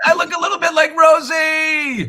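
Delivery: very high, loud gleeful shout; delighted yell. Real, not acted. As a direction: shriek it with amused delight. Delighted yell at being teased.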